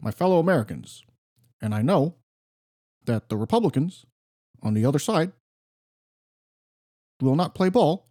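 a light Obama impression, presidential and deliberate; Barack Obama impression. Co-host does his Key and Peele style Barack Obama impression. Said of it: "I do my Barack impression".

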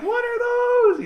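squeaky mocking kid; mock it high and squeaky.

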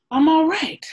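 high, loud, emphatic. Real, not acted. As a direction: belt it out, insisting. Emphatic repeat.

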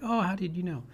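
a hint of a delighted child; mock surprise. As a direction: imitate a kid caught out, playfully amazed. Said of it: "And she'd be like".

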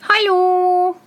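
high, sustained halloo; called out. A narrator's reading. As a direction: hail them from afar in a high voice. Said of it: "schrie Tölpelhans".

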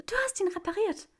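high, breathless delight; delighted gasp. A narrator's reading. Granger seeing the repaired ring, high delighted gasp. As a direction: gasp it out, thrilled. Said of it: "keuchte Granger entzückt".

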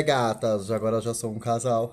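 ordinary talk

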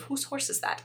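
hushed, whispery Scottish meme voice; soft Scottish accent. Retold or imitated. Friend naming her current meme, soft Scottish accent. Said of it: "in a very soft Scottish accent".

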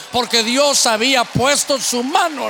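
usual speaking voice

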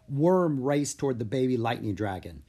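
plain reading voice

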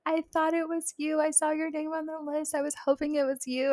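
a hint of a delighted caregiver; sweet assistant. Dental assistant happily greeting her returning nervous patient. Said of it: "she was like".

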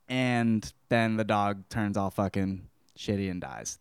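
ordinary talk

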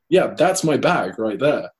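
slow, steady, back near his own pitch; his own firm reply. Retold or imitated. He answers the lady calmly in his own voice, slowly. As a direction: drop back down and state it slowly.

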